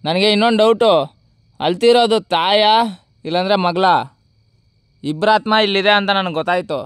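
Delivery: shouting into the dark, high and loud; loud calling out. Real, not acted. Ghost hunter alone at night calls loudly to the spirits. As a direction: call out loudly to unseen spirits.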